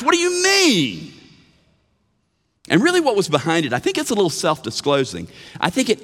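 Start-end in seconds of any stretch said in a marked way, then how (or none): 0.0-1.1 high, slow, stunned shout; astonished shout. Retold or imitated. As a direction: cry it out in disbelief. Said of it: "they were astonished".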